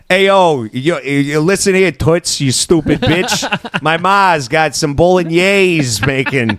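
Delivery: brash mock New York Italian; Italian-American accent. Cocky guy hitting on a girl, mock New York Italian accent. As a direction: swagger through a hammed-up Italian-American accent. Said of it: "Italian accents are hot".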